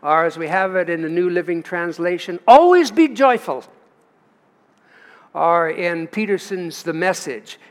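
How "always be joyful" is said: louder and higher, stress on "Always"; proclaiming. Acted. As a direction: lift "Always" up and out. Reading the translation aloud.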